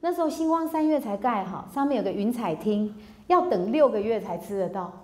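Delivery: ordinary delivery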